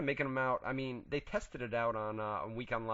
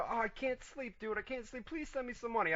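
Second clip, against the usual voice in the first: mock pleading Boone; 10 semitones higher than usual. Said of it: "seven o'clock in the".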